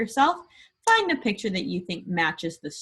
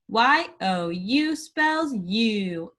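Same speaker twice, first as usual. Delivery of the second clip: letters spelt out slowly; slow spelling; much slower than usual. Shown as a demonstration. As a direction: spell each letter with a pause. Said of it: "spell it".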